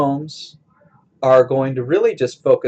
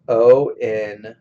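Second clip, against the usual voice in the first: scanning a verse; much slower than usual. Shown as a demonstration. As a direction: split foot by foot with long pauses. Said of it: "if I look at the next line".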